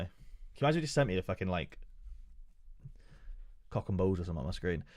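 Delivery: ordinary talk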